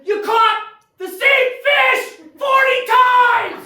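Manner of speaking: high, slow, full-force shout; exasperated yell. Acted. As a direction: holler it phrase by phrase, hammering each one. Punchline yelled at fishing friend.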